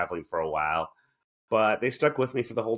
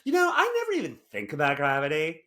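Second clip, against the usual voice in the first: teasing his co-host with a higher, faster quote of her; mock playbill bio voice; 7 semitones higher, faster than usual. Teasing his co-host by quoting her as a playbill bio, mock-smug. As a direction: rattle it off like a smug bio line, pitched up. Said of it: "This should be in your playbill bio".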